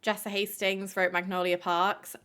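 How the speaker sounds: usual speaking voice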